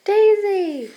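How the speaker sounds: high bright call of a name. Acted. Greeting someone by name from the bike, high called-out cry. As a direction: call her name across the street.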